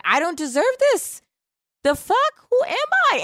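high, squeaky mock disbelief; mock self-doubt. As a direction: play the overwhelmed birthday girl. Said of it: "I was like".

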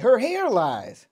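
high, slow, drawn-out punchline; retold punchline. Co-host quoting a show's joke about Georgina, drawn-out punchline. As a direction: stretch out the mock insult. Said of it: "He's like".

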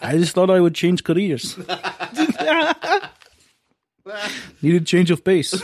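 loud, laughing cartoon Russian accent; old cartoon Russian accent. Cartoon Russian accent for Putin joking about changing careers. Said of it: "He talks like a old cartoon Russian guy".

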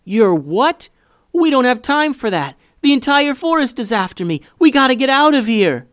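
high, loud frantic shouting; panicked. A narrator's reading. Boy still panicking about the forest, high frantic shouts. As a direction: blurt it all out in a panic. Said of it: "clearly panicked".

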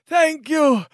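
high thankful noble cry; a relieved lord thanks the heroes; grateful nobleman. Acted. Noble thanking the party, high and grateful.

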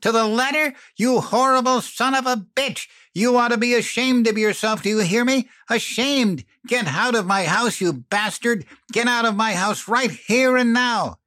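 high, loud screaming tirade; shrieking rage. A narrator's reading. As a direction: pound out each word in savage rage. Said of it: "she shrieked".

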